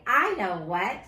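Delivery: usual speaking voice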